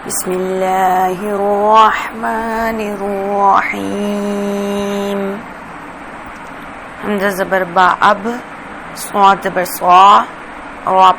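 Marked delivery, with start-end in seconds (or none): 0.1-5.2 very slow, drawn-out Quranic chanting; tajweed recitation. Acted. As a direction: recite each word long and melodic. Teacher recites before lesson. (بہت آہستہ، کھینچ کر تلاوت)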